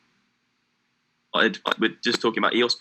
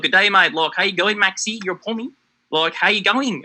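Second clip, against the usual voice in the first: higher-pitched mock Aussie accent; Australian accent; 8 semitones higher than usual. Guy in an Australian bar greeting a traveler, imitated Australian accent. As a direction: put on a broad Australian greeting, imitating a local. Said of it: "don't know why I'm doing the accent".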